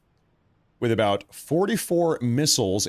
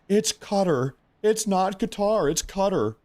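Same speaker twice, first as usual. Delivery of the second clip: higher, slow, know-it-all correction; mock pedantic Westerner; 8 semitones higher than usual. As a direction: lecture them like a smug Westerner fixing your pronunciation. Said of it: "all these Westerners".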